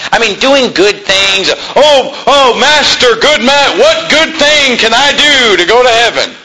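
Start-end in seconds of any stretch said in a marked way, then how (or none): 1.7-6.3 grovel to the Master in a hammed-up voice; mock pleading seeker. Preacher mocks a man asking the Master how to earn heaven, hammed-up pleading.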